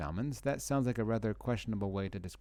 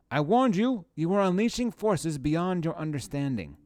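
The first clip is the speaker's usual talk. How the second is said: loud, high, scolding cry of I warned you; angry warning; 6 semitones higher, 5 dB louder than usual. Acted. Streamer reading the shopkeeper's warning out loud, angry. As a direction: shout the warning like a furious shopkeeper.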